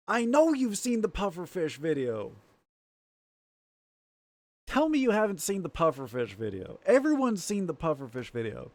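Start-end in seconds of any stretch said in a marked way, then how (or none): none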